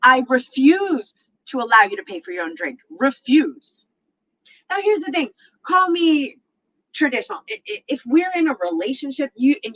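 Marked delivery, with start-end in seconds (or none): 0.0-3.9 pompous stress on Refuse; lightly mocked insistent guy; insistent refusal. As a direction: lean hard on Refuse like a stubborn man. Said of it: "Three of them have said this".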